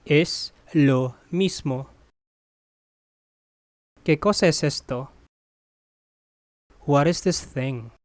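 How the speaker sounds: ordinary talk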